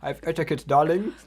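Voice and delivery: put-on high, loud posh accent. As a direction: play the refined gentleman. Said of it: "You are posh".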